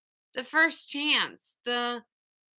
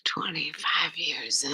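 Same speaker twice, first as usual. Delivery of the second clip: soft, lower voiceless whisper; 8 semitones lower, 5 dB quieter than usual. Real, not acted. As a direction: whisper it like a secret prompt. Whispered prompt to partner.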